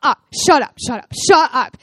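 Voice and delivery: loud, gleeful shut up, repeated; excited shut up. Retold or imitated. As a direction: yell it in happy disbelief. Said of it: "all they hear is me going".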